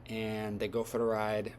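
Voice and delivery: ordinary delivery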